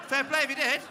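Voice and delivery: big, high, loud shout of praise; loud cheer. Real, not acted. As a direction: bellow it out like a hearty toast. Cheering asthmatics who climbed hills.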